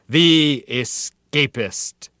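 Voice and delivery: slow, grand dramatic reveal; gleeful announcer reveal. Acted. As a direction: unveil the hero's name slowly. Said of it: "helpless, gleeful glance on the point of vanishing completely into his story".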